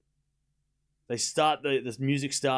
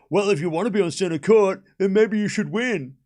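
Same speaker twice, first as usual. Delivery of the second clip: mocking commenters; 4 semitones higher, 5 dB louder than usual. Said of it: "people are like".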